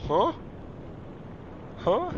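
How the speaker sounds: high startled huh; surprised. Real, not acted. Surprised by ship.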